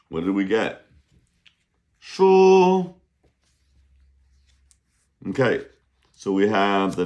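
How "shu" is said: high, loud reveal of the word; excited announcement. Real, not acted. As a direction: announce the card brightly. Reading card aloud.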